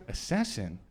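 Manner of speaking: normal talking voice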